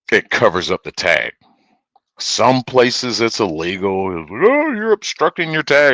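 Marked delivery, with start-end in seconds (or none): 4.4-5.9 higher, louder imitation of a complainer; mock objection. Streamer imitating someone objecting to the tailgate, mock complaint. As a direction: put on a fussy, raised-pitch objection.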